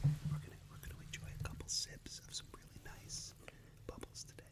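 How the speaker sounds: soft breathy ASMR whisper into the mic; teasing ASMR whisper; mock ASMR whisper. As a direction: parody an ASMR video, whispering close. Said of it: "those A S M R videos".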